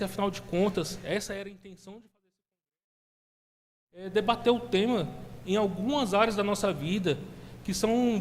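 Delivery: loud, impassioned sermon delivery; preaching. Acted. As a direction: preach it out with fervor. Preaching builds to full force.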